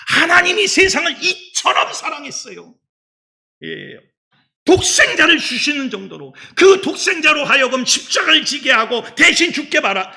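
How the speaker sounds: high, loud shouted sermon; preacher shouting the good news at full force; fiery preaching. Acted. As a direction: preach it with full fire to the congregation.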